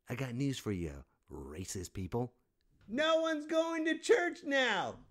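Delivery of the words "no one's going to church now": high, slow, loud jeer; triumphant high-pitched jeer; mocking shout. Real, not acted. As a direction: yell it in their faces. Gleeful shout at racists.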